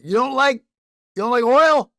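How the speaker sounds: loud, high brash patriot; mock patriot voice. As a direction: boast like a flag-waving fan. Mock MAGA snack voice.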